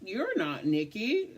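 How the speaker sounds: mock coworker. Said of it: "everybody kept coming over there and just".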